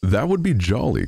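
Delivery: high, lightly exclaimed delight; delighted cry. A narrator's reading. Miller hears of hidden wine, crying out. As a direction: cry out with pitch lifted, not volume. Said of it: "cried the miller".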